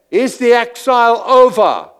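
high, loud challenge; preacher asks the question with force; pointed question. Acted. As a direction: fire the question at them.

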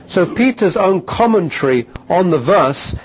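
loud, rising preacher's declamation; full-force preaching. Acted. Preacher drives home his point to the congregation, loud and fervent. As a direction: proclaim it to the congregation.

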